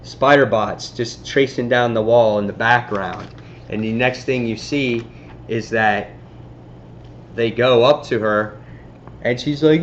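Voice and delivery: usual speaking voice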